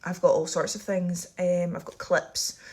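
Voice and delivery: ordinary talk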